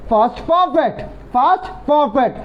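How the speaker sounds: drawn out very slowly; slow drill. Shown as a demonstration. Teacher answering for the class, very slow. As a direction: stretch every syllable. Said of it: "আগে কোন tense".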